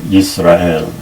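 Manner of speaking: "Yisrael" with a clear Y at the start; modern Hebrew Yisrael. Shown as a demonstration. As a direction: say "Yisrael" as yis-ra-el, Y sounded.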